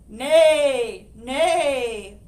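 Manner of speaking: loud neighing nay; whinny it like a horse. Acted. Horse refusing the hen's plan, loud whinny. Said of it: "whinnied the horse".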